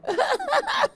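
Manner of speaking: high delighted laugh; bright laugh breaking out; burst of laughter. Real, not acted. Laughing at the story.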